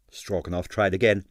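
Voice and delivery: usual speaking voice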